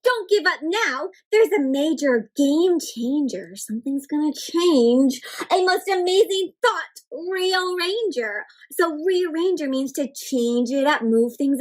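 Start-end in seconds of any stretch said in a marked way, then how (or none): 0.0-8.4 slow wondrous read-aloud; reading to children. Acted. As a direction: read like revealing magic. Reading picture book aloud.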